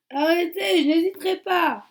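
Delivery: loud, high warning shout; deputy yelling at two masked men, loud and high; desperate shout. Acted. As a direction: shout him down before he fires. Owen threatening the armed killers.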